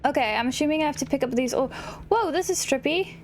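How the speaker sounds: usual speaking voice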